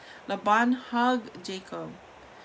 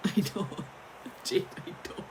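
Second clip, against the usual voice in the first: laughing; 7 dB quieter than usual. Real, not acted. Reader breaks up laughing.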